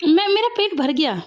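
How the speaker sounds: ordinary talk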